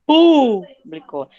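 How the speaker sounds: emphatic classroom demo; stressed demo. Shown as a demonstration. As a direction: hit pú in público, slow and clear. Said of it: "São três".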